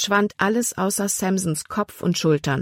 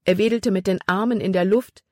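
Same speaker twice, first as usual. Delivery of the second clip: plain reading voice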